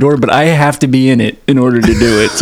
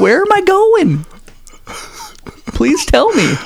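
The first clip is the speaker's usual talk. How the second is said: high, slow pleading excitement; mock eager; slower than usual. Teasing friend, pretending to crave the free vacation. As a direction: beg sarcastically for the trip.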